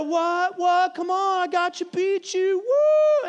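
put-on high, loud bragging kid voice; mock child gloating with a whoop; kid's taunt. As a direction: strut and brag like a cocky seven-year-old. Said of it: "And he's over there".

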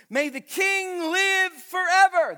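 high, loud, slow flattering plea to the king; flattering courtier. Acted. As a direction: lay it on thick like a courtier buttering up a king. Preacher acting flattery to king.

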